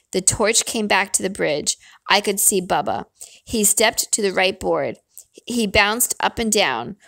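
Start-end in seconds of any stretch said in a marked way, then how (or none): none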